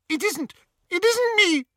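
high, panicked denial; terrified squeak. A narrator's reading. Hermione denying it to Greyback, terrified squeak. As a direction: squeak it out in terror. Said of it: "Hermione's terrified squeak".

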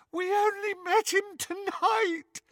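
high, tearful sobbing plea. Acted. Hermione sobs to Bellatrix under torture, high tearful plea. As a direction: sob it out in terror. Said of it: "Hermione sobbed".

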